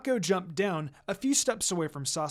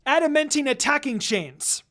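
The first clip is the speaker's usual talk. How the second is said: higher, louder battle cry; jutsu shout; 7 semitones higher, 6 dB louder than usual. Acted. Karin shouts her technique at Minako, loudly. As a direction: call out the attack.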